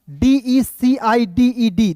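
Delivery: letters of डीईसीआईडीईडी spelt out very slowly; slow spelling. Shown as a demonstration. Teacher spells the word slowly to students. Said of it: "देखिए यहाँ पे डीईसीआईडीईडी".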